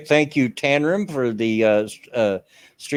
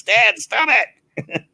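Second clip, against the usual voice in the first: quoted protest, pitched up and louder; mom's scolding; 11 semitones higher, 5 dB louder than usual. Retold or imitated. Reading out family's message to Dad, mock scolding. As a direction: imitate an embarrassed family member cutting Dad off. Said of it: "Mom says".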